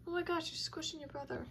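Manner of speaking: ordinary talk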